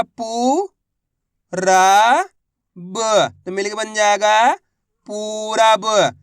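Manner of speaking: syllables spaced out, then a very slow, loud पूरा ब; धीरे धीरे अक्षर जोड़कर पढ़ाना; slow syllable blending. Shown as a demonstration. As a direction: teach it letter by letter, stretching the final word. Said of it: "मैं किस तरीके से एक अक्षर का उच्चारण करके".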